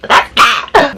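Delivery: very high, loud squawked exclamation; mock outrage. Storyteller on the driver's nerve, high mock outrage. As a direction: squawk the word high in exaggerated disbelief.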